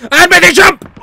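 high, loud burst of urging; tense shout. Real, not acted. Player making a risky jump, tense shout. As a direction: shout it on the leap.

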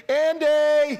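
prompting the congregation. Real, not acted. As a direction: call it out for the crowd to finish. Cueing congregation to supply word.

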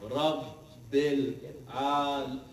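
drawn-out, very slow recitation; slowest prayer recital. Shown as a demonstration. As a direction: stretch every syllable of the prayer. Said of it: "slowest namaz you've ever heard".

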